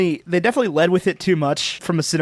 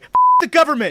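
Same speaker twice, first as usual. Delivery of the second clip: loud, high defiant outburst; defiant shout; 11 semitones higher, 5 dB louder, faster than usual. Retold or imitated. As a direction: yell it in defiance. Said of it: "And then she's like".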